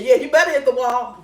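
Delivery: ordinary talk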